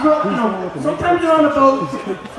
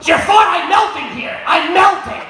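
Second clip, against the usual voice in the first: Gilbert Gottfried impression; 6 semitones higher than usual. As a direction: squawk it out like Gottfried. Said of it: "my impression of Gilbert Gottfried".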